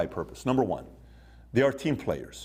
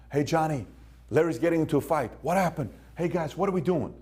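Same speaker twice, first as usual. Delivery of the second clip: urgent call; 7 semitones higher than usual. Retold or imitated. Friends call him over a fight.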